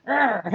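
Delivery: imitated growl of frustration; mock fed-up growl; frustrated growl. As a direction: let out a short exasperated urgh. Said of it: "I will cry out of frustration".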